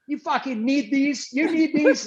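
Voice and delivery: high-pitched mock angry challenge; retold angry yelling. Retelling the coach's jab about glasses to teammates, mock angry yell. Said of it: "he's yelling at me".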